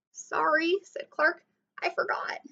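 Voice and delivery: high sorry fading to a breathless voice; embarrassed, trailing apology; sheepish shark. A narrator's reading. Clark apologizes again.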